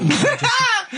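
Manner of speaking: sudden high laugh; high burst of laughter. Real, not acted. Friend laughing at the biscuit story, high burst of laughter.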